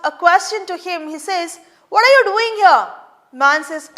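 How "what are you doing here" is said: loud, high demand; challenge. Retold or imitated. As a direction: call him out. Watchman challenges the man.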